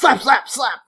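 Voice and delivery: high, loud, punchy repeated word; voiced slaps. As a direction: hit each word like a slap.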